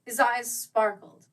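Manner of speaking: ordinary delivery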